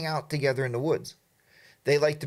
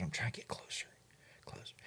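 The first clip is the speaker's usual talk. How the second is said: softer whispered aside, no voice at all; 6 semitones lower, 16 dB quieter than usual. Retold or imitated. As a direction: sneak the words out so the elk won't hear. Sneaking up on elk.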